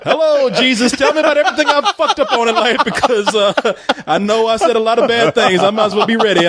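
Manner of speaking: high, laughing mock plea to Jesus; mock prayer to Jesus. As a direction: ham up a panicked last confession. Imagined last words to Jesus.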